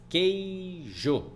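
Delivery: drawn-out "Queijo" as quei... jo; syllable division. Shown as a demonstration. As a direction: say "Queijo" syllable by syllable, full final o. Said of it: "Vai ficar sempre na mesma sílaba".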